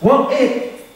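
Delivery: usual speaking voice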